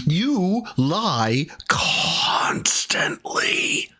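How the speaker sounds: slow, trembling whispered fury; seething whisper. Real, not acted. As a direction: hiss it through barely held rage.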